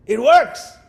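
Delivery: sudden loud cry of success; triumphant doctor. Acted. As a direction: burst out with glee. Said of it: "the doctor looks up and says".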